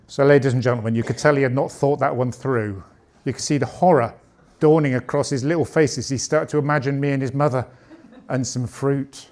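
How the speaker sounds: normal talking voice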